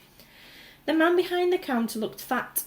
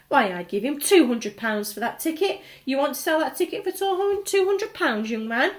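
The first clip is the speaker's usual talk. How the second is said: louder eager woman; loud bidding; 5 semitones higher, 5 dB louder than usual. A narrator's reading. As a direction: outbid him loudly. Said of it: "shouted a woman".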